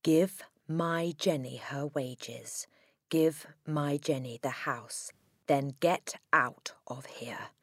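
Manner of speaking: slow, whispery menace; ghostly threat. A narrator's reading. Dead Magnus threatening Agatha, in a slow whisper.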